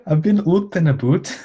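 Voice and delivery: high, laughing bad Scottish accent; mock Scottish accent. Co-host imitates Rose's bad Scottish line, mock Scottish accent. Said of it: "Scottish accents".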